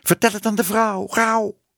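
high, mewing cat calling out; roepende poes. A narrator's reading. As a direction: call it like an urgent cat. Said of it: "riep de poes". (hoge, dringend mauwende poezenstem)

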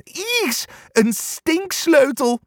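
squeaky high disgusted cry; two ears crying out. A narrator's reading. The ears shrink from the stink key, high squeal. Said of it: "roepen de twee oren".

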